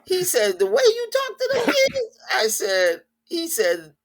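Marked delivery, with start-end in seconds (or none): 0.6-2.2 high, loud mock student shout; loud squeal imitating the student; retold exclaim. Student scolding coach, in a high loud mock shout.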